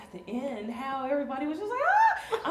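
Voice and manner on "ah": mock crowd cheer.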